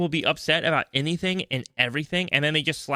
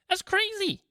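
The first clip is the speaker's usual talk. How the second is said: squeaky high-pitched disbelief, said twice; mock disbelief; slower than usual. Podcast host squeaks in falsetto at the idea.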